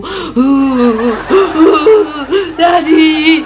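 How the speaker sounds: loud, high, long-drawn fake sobs; mock wailing. Child crying to dad, exaggerated loud sobbing. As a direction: wail "Daddy" slowly and pitifully.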